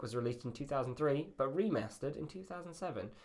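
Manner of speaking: usual speaking voice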